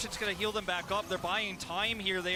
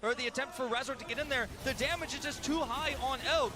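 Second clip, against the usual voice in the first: energetic play-by-play, pitched up; esports caster; 8 semitones higher than usual. Acted. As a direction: call the fight like a live caster. Live play-by-play of teamfight.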